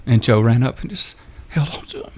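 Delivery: really choking up on the words; getting emotional. Real, not acted. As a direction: let emotion overtake the end of the line. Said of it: "I apologize for getting emotional".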